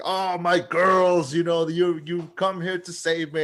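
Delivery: gushing mock greeting. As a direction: imitate a dad overjoyed at his rescuers. Acting out the rescued father's greeting.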